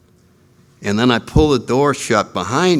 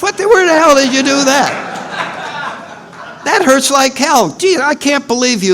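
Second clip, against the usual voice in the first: higher and louder outraged complaining; indignant tirade; 10 semitones higher, 3 dB louder than usual. Retold or imitated. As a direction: give him hell, high and loud. Actor berates the lion actor.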